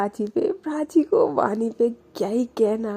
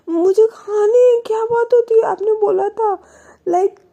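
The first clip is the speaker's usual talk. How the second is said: put-on much higher, louder childish whine; whiny tantrum; 13 semitones higher, 7 dB louder than usual. Prachi insisting on momos to Vani, whiny childish tantrum. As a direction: wail it like a stubborn child.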